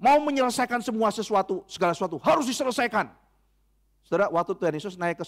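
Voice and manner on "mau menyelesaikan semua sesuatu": ordinary talk, nothing marked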